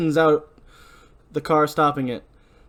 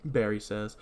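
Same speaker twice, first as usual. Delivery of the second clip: usual speaking voice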